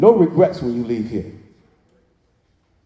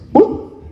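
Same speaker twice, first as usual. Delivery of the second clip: mock sound effect; 4 dB louder than usual.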